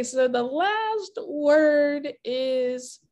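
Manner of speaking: very slow, drawn-out lead-in; suspenseful reveal. Real, not acted. Host announcing the final word to player, slow suspenseful build. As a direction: stretch the words toward the reveal.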